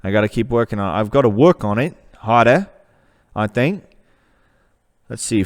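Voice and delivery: a shaky put-on Australian accent, slipping at times; amateur Australian impression. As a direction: play an outback Aussie named Bagger. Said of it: "great Australian character".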